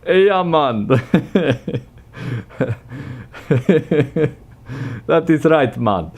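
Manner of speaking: laughing mock Jamaican slang; mock Jamaican accent. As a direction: play a cartoon Jamaican, laughing through it. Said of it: "[name] went Jamaican now".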